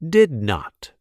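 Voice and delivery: ordinary delivery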